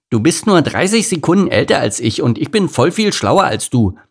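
put-on high-pitched childish spite; spiteful retort. A narrator's reading. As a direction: snipe at a sibling like a catty child. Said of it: "giftete Lars".

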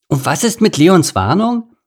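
little Ede blurting a question; eager ground squirrel. A narrator's reading. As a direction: pipe up unasked. Ede speaks up.

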